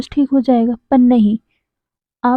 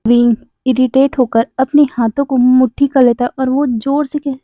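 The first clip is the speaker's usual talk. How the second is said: ordinary delivery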